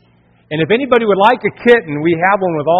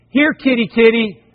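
higher coaxing kitty call; calling the cat; 4 semitones higher than usual. Retold or imitated. Father calls up to the cat in the tree, coaxing. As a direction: coax a cat down gently. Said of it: "I said".

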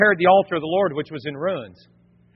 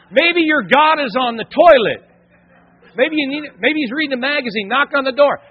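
high, loud taunting; trash-talk them with relish; raised, jeering smack talk; 7 semitones higher, 6 dB louder than usual. Acted. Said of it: "He began to talk smack".

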